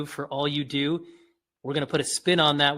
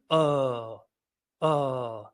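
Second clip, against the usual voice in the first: two long falling UH sounds; slow vocal-exercise "uh"; drawn-out demonstration; 2 semitones lower than usual. Shown as a demonstration. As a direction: hold each UH open, then let it drop. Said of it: "Those are sounds that help".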